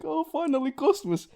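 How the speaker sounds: put-on a thrilled old bartender greeting his first customers; delighted old barkeep. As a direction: pitch way up like an eager old man. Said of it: "The old man's just like".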